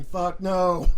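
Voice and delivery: normal talking voice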